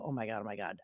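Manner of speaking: low, soft mock Oliver mumble; a hint of John Oliver muttering; imitating John Oliver. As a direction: drop low and soft like him. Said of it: "the voice Oliver go".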